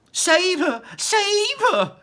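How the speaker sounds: high, loud frantic scream. Acted. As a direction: scream for help as the child is seized. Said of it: "screamed Missus Salt".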